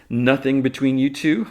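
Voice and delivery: loud, high mock-innocent question; teasing reporter. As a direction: needle them as a showy reporter. Voices Cat needling Jimmy.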